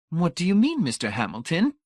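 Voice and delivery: very high, troubled lady's voice. A narrator's reading. Missus Pritchett questions Hamilton's theory, troubled. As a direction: ask in a high, worried character voice.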